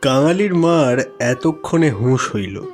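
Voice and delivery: usual speaking voice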